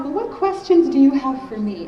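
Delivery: high, loud, over-bright parental question; hammed-up open invitation to the kids; imitated eager parent. Parent voiced addressing kids.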